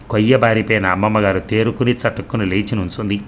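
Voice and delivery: plain reading voice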